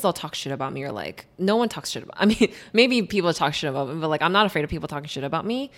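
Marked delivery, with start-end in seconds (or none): none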